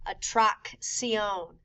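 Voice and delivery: atracción very slowly, a K then an S sound; double C demo. Shown as a demonstration. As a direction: break atracción between the two C sounds. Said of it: "The second C sounds like an S".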